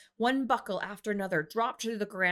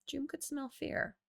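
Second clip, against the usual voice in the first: ordinary delivery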